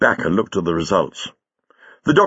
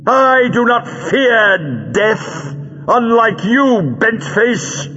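high, slow, fierce declaration; defiant shout; 11 semitones higher, 3 dB louder, much slower than usual. A narrator's reading. Captive woman facing death at villain's hands, defiant shout. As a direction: hurl each word at the captor. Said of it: "she shouted".

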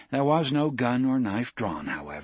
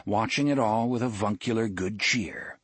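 ordinary delivery

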